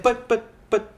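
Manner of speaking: high, halting mock protest; imitated stammering. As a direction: stammer it like someone stuck for words. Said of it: "she doesn't know how to, like, say".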